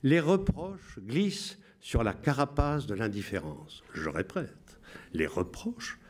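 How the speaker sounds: solemn Radio Londres announcer. Shown as a demonstration. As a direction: read the coded messages like a BBC broadcaster. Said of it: "Je vous lis avec la voix".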